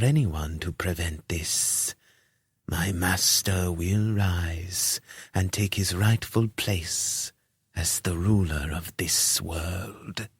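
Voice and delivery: slow, soft, sinister lisp fading to a whisper; a hushed lisping cultist; lisping robed priest. Acted. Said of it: "spoke with something of a lisp".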